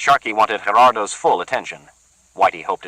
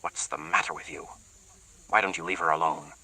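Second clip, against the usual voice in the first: muttering; 8 dB quieter than usual. Acted. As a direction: mutter it under your breath. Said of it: "Sharkey muttering to Bertha".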